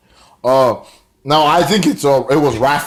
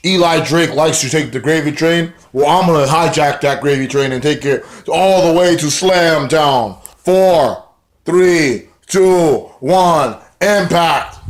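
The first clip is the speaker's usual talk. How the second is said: higher-pitched wrestling promo delivery; Johnny Impact promo; 4 semitones higher, slower than usual. Retold or imitated. Acting out Johnny Impact's interview promo.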